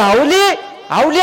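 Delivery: high call, rising at the end. Acted. Husband at the door calls his wife, calling out. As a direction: call her name twice from the door. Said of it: "दारात आले ना आवाज दिला".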